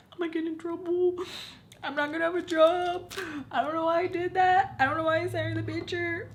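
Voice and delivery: acted high-pitched panicked whine; freaking out. Retelling her fear of consequences, freaked-out wail. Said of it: "so I'm, like, freaking out".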